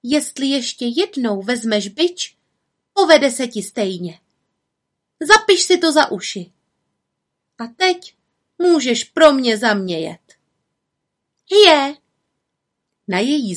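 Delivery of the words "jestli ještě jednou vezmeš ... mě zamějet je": mild scolding threat, pitch climbing; threatening. A narrator's reading. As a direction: warn the coachman with a touch of sternness. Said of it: "Hrozila mu".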